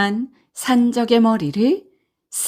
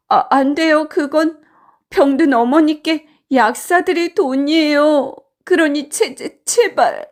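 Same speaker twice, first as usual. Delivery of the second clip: higher-pitched, stammering plea; pleading for mercy, voice raised in pitch; desperate pleading; 5 semitones higher than usual. Acted. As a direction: beg the bandits as a frightened boy. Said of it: "살려달라고 애원했지요".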